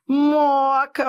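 Moca said slowly with an open o; modeling pronunciation. Shown as a demonstration. Teacher shows students how to say Moca. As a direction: draw out Moca for the class. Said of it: "É assim que você tem que falar".

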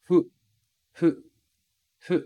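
Fu blown out like a candle, between F and H; Japanese fu. Shown as a demonstration. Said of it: "como se você estivesse assoprando uma vela".

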